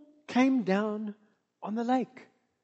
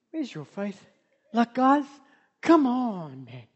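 gentle. Shown as a demonstration. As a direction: ask it tenderly, almost under the breath. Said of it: "It's like".

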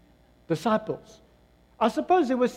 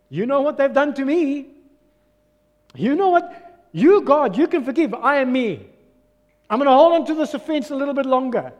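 raised, louder mock refusal; defiant; 4 semitones higher, 5 dB louder than usual. Believer telling God they will keep the grudge, defiant. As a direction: argue back at God, higher and louder.

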